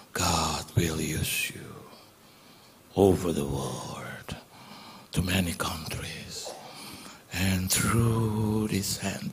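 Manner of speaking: very low, slow, hushed delivery; whispered prophecy. Retold or imitated. Preacher prophesying over him as he holds his hand, slow whisper. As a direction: imitate a preacher's solemn half-whisper, word by word. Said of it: "Itu duduk dia bisik".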